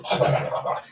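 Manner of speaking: noisy mock gobbling; imitated devouring noise. Showing how the little creatures ate him, imitated devouring noise. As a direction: make a quick wordless munching noise.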